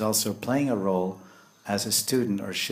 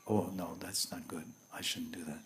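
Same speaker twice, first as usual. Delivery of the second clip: very soft, low aside; hushed inner warning; 3 semitones lower, 12 dB quieter than usual. Acted. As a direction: murmur it like a quiet voice of conscience. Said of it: "the voice of God, you could say".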